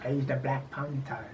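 hushed, low echo of a hero's introduction; mock announcement. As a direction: echo it very softly, deep in pitch. Echoes the character introduction.

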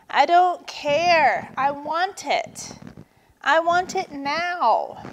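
high-pitched, whiny moan. A narrator's reading. Turtle demanding Vulture's bracelet, moaning. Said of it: "Turtle sniffed and moaned".

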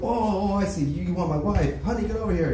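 high, eager rich-man voice; gushing billionaire. Acted. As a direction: fawn over the hero like a giddy tycoon. Billionaire offers his wife.

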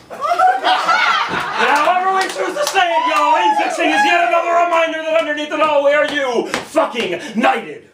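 high, loud slam-poem finish; climactic shout. Acted. Poet uniting the crowd at the poem's end, climactic shout. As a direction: belt out the final line to the whole room.

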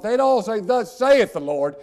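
loud, high thundering sermon cry; preacher raising the rafters; preaching at full force. Acted.

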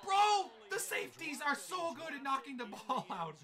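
high, loud burst of disbelief; amazed exclamation. Real, not acted. Gamer marveling at his safeties, amazed shout. Reacts to a knocked-out ball.

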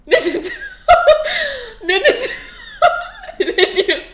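laughing while asking. Real, not acted. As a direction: giggle through the questions. Laughing fit, can't recall sentence.

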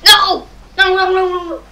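frustrated shouting, fading out; dismayed shouts of no. Real, not acted. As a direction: yell no at the screen as it goes wrong. Play goes wrong, yells.

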